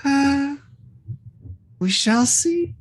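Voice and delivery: high, measured creature voice; ominous high monster reply. Acted.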